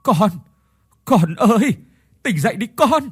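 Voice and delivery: a kneeling father crying out in grief; anguished, mourning call; grief-stricken cry. Acted. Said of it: "giọng thương tiếc".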